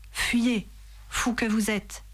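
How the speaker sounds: understated last order; a touch of urgency, no real shout; mild narrated cry. A narrator's reading. Gandalf vanishing into the abyss, restrained cry. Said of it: "cria-t-il disparaissant".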